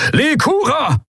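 very high, desperate yell of a name; bellowing. Acted. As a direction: roar her name across the bridge. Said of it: "brüllte Thelios".

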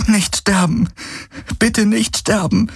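high, desperate plea; pleading. A narrator's reading. Kirai begs Hawks not to die, high and desperate. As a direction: beg him with everything. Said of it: "flehte sie".

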